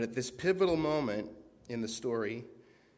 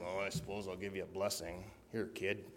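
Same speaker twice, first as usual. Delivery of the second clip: begrudging; 3 semitones lower, 6 dB quieter than usual. Pretend. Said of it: "he's not begrudging".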